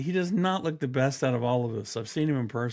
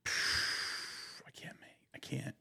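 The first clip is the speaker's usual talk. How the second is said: whisper; 13 dB quieter, slower than usual. Real, not acted. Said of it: "laughed out".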